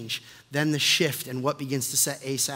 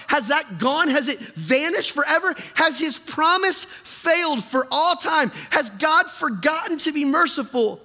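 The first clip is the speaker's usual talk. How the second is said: raised, urgent preacher's questioning; impassioned preaching; 8 semitones higher, 3 dB louder than usual. Acted. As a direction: press each question home, pitched high.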